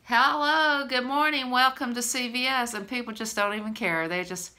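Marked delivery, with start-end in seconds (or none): 0.0-2.8 bright, high-pitched store greeting; imitated cheery shop greeter. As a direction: sing out a welcome to customers.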